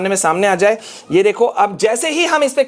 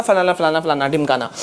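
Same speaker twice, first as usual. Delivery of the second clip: dismissive blah-blah; 7 semitones lower than usual. Real, not acted. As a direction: rattle off filler as it sinks away. Skims official text with filler words.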